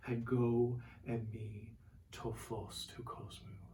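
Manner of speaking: slow, careful Koine Greek reading of "Ego eimi tophos tu kosmou"; Greek quotation. Shown as a demonstration. Preacher quoting Jesus, slow solemn Greek. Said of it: "I am the light of the world".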